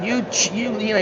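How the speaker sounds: high, sputtering mock protest; imitating a furious fighter. Fighter sputtering at the ring after losing, mock fury. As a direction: stammer it out like a boxer who feels robbed. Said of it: "he's going nuts".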